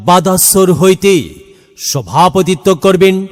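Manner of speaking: usual speaking voice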